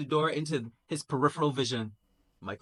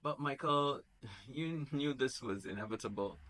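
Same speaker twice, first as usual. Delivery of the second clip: comforting; 7 dB quieter than usual. Acted. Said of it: "trying to comfort".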